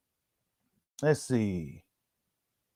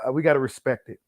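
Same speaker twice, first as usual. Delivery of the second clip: usual speaking voice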